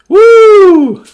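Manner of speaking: high, loud whoop; excited whoop. Real, not acted. Breaker sharing his thrill with viewers, loud and high. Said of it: "Hope you all are excited as I am".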